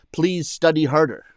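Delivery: usual speaking voice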